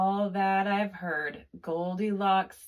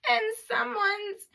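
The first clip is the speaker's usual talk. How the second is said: much higher, small crying cub; Baby Bear's tiny voice; 13 semitones higher than usual. A narrator's reading. Narrator voices Baby Bear crying over his chair, high and tiny. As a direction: squeak it out, upset little bear. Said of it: "cried the baby bear in his wee small voice".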